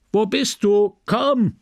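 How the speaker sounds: high urgent call, rising on Komm; husband calling his wife to come. A narrator's reading. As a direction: shout for her from below. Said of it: "schrie Charles".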